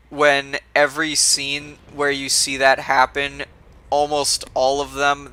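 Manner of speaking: ordinary talk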